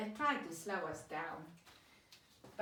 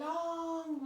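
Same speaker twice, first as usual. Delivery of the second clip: LONG stretched out on a held vowel; drawn-out word; 7 semitones higher, 3 dB louder than usual. Shown as a demonstration. Teacher showing a word looks big, LONG drawn out. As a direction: hold the vowel of "long" for a long beat.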